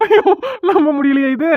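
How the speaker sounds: laughing through the words. Acted. Friend laughs at the groom's bad luck, giggling. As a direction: giggle out the disbelief.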